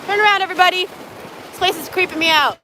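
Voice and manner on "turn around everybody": high, loud call to dogs. Real, not acted. Calling dogs back.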